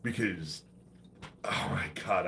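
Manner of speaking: ordinary delivery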